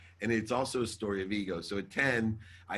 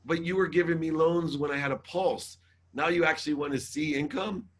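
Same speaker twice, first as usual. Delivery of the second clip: higher, disbelieving protest; indignant protest; 6 semitones higher than usual. Retold or imitated. Borrower arguing with the bank over changed rules, indignant. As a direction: argue back in mock outrage.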